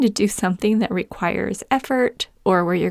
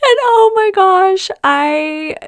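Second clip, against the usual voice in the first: laugh-crying; 12 semitones higher, 6 dB louder, much slower than usual. Real, not acted. Recalling crying on wrong train.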